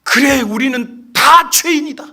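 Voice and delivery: slow, high shout building to full roar; commanding exorcism cry. Retold or imitated. Young pastor confronts the demon before congregation, crying out commandingly. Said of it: "이 목사님이 외쳤던 한마디".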